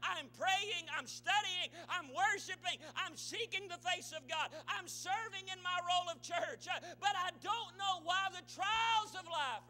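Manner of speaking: loud, high, building sermon delivery; anguished preaching plea. Acted. Struggling believer pleads with preacher, impassioned shout. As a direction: pile up the pleas at full force.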